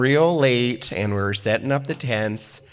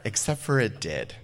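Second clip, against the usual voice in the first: normal talking voice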